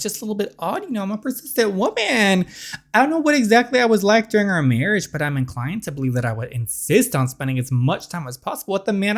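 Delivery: high, loud put-on flirty voice; odd sweet voice. Cerise flirting at lunch with Sawyer, high put-on voice. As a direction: coo it, sugary and strange. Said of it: "why are you speaking like that".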